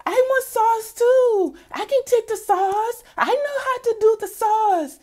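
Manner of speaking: very high squealing pleading voice; mocking high-pitched Karen; whining Karen impression. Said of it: "Karen is on the sideline like".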